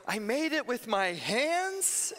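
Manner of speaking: put-on a kid sure he did it himself; proud little boy. Nephew boasting to the plane that he made the landing himself. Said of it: "And then he goes".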